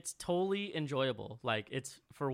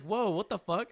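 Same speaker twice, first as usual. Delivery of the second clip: mock shock; 7 semitones higher, 5 dB louder than usual. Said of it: "And I was like".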